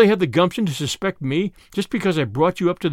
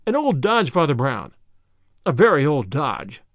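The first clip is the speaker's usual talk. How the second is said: plain reading voice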